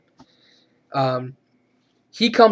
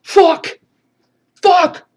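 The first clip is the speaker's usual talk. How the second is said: high, loud mock cursing; short high bursts of mock panic; imitated yell; 13 semitones higher, 5 dB louder than usual. Man who doesn't even try to escape, mock yelling. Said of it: "he just yells".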